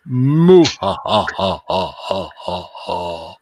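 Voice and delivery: slow, deep villain cackle; evil chuckle. Pretend. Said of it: "I could do better, like".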